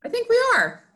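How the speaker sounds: high, bright show-host welcome back; excited. Real, not acted. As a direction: announce the return with glee. Cheerful show restart.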